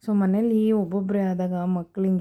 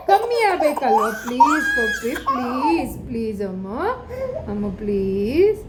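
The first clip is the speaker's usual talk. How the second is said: pleading; 6 semitones higher, 6 dB louder than usual. Real, not acted. Mother pleads with crying child over medicine.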